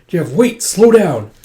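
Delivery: high, loud call to stop. Real, not acted. Vlogger calling out loudly to Jeff to slow down. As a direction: shout at him to slow down. Calling out to someone mid-recording.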